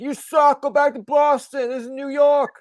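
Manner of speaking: high, loud New York heckle; heckling New Yorker yell. Acted. New Yorker heckling a visiting touring musician, mock angry yell. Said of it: "the New Yorker will yell out".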